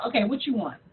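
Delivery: low, quiet, resigned question; wary listener. Retold or imitated. Voicing Todd answering a suspicious call, wary. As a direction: answer guardedly, expecting a favour. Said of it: "Todd's gonna automatically be like".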